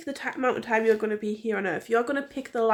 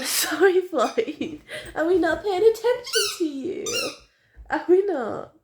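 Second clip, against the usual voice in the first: playful pet talk; 8 semitones higher, slower than usual. Real, not acted. Talking to her dog Floyd.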